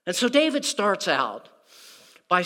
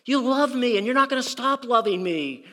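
higher, pleading David; David begging for mercy, pitched up; voicing David; 6 semitones higher than usual. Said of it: "David looks up and says".

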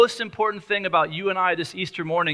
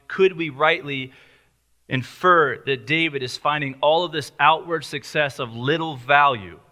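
normal talking voice

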